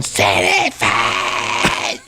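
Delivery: very slow drawn-out mock chant; lightly voiced Cartman chant; imitating Cartman. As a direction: drag it out like a cartoon kid. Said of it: "running around going".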